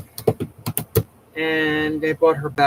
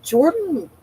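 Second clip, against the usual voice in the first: calling out; 13 semitones higher, 4 dB louder than usual. Real, not acted. Calling out to Jordan.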